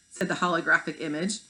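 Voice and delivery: ordinary delivery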